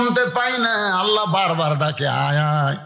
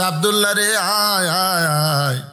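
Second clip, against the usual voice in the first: imitated father's repeated summons; father calling out; 2 semitones higher than usual. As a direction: call the missing son home in a chant. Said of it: "আর ডাকতেছে".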